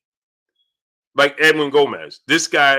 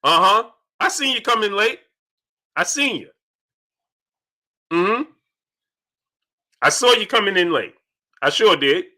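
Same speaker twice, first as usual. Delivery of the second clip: teasing sing-song; 5 semitones higher than usual. Acted. Teasing a late viewer.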